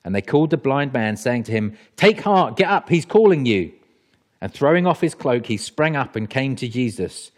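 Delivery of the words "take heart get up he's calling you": high, loud urging; raised-voice rallying call. Acted. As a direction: call to him to get up. Crowd calls to the blind man.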